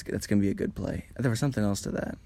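usual speaking voice

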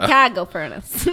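put-on loud, mangled Chicago accent; shitty accent. Trying out accents with co-host, loud bad Chicago accent. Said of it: "Shitty accents".

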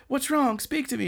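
high, urgent, theatrical plea; mock concern. Boy catching a fainting girl, high mock worry. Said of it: "And he's like".

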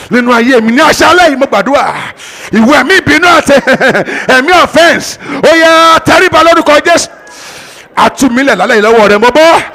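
loud, high, urgent deliverance prayer; fervent prayer. Real, not acted. Pastor crying out for deliverance before the congregation, loud and high. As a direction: cry out to God with rising force.

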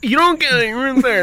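high mock-weepy whine; hammed-up crying voice; tearful protest. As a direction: protest as if choked up. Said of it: "crying, whatever".

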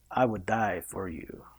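low, soft, slow suitor's line; romantic accent. Retold or imitated. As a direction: croon it slowly and tenderly. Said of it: "You need to perfect that accent too".

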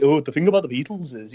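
[name]. Acted. Showing outdated [name] Scouse, low Scouse accent. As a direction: lightly put on an old-fashioned low Scouse accent. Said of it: "it's not Ringo like".

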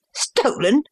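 high, rising cry of disbelief; startled cry. A narrator's reading. Accused son to his father, startled cry. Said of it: "he cried".

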